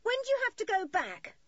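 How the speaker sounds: very high, loud question; calling out. A narrator's reading. Maggie asks children when they leave, calling. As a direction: call it over in a high woman's voice. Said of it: "she called".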